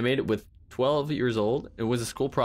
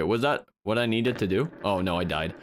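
ordinary talk

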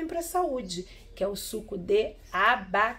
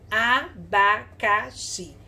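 "abacaxi" split evenly as a, ba, ca, xi; syllable by syllable; much slower than usual. Shown as a demonstration. Teacher reading out the written word, syllable by syllable. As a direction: read "abacaxi" one syllable at a time. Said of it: "Quatro sílabas".

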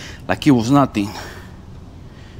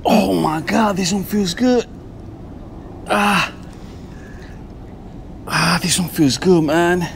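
excited; 6 semitones higher than usual. Real, not acted. Thrilled angler feeling a big fish.

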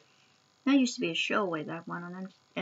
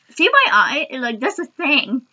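laughing while eating sour candy; giggling mid-bite, face scrunched; laughing through sourness; 5 semitones higher, 6 dB louder than usual. Real, not acted. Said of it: "an uncontrollable, involuntary thing".

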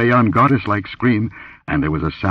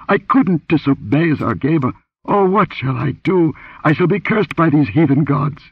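high-pitched, wailing plea; abject fright; 9 semitones higher than usual. A narrator's reading. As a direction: lament your fate in terror. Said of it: "quivering with abject fright".

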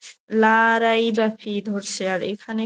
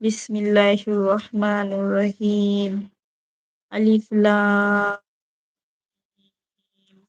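slow, measured Quranic recitation; much slower than usual. Acted. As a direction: draw each Arabic syllable out slowly. Reads Quran verses aloud. (ধীর কোরআন তিলাওয়াত)

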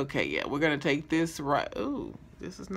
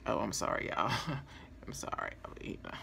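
soft breathy sorry; whispered apology; 7 semitones lower, 6 dB quieter, much faster than usual. Real, not acted. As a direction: whisper it like you got caught.

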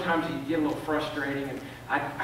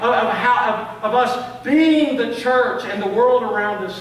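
higher and louder, pressing the congregation; preaching at full force; 6 semitones higher, 9 dB louder than usual. Real, not acted. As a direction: drive it home like a preacher.

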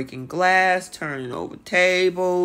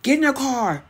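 shrill-ish pleading yell imitated; retold yelling; 8 semitones higher, faster than usual. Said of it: "she started yelling at Ryan".

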